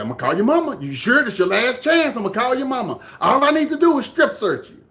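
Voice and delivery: high, increasingly loud imitated threat; retold warning. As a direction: act out a man pressuring the kids.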